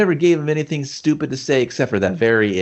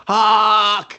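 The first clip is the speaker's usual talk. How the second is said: high, drawn-out yell; calling out; 9 semitones higher, 4 dB louder than usual. Retold or imitated. Man urging on Hawk at the match, high yell. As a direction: holler the name across the arena.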